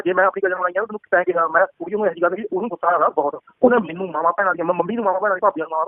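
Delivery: usual speaking voice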